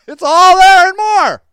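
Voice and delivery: high, loud laugh-cry through the words; real laugh-cry. Real, not acted. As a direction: let the laughter break the line. Laughing about the chaos.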